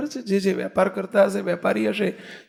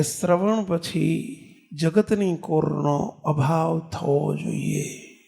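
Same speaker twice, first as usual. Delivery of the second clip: slow solemn emphasis; 4 semitones lower, much slower than usual. Shown as a demonstration. Preacher to the congregation, restating the Vachanamrut: slow and solemn. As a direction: lay the teaching down slowly and gravely. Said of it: "preacher stresses the key teaching".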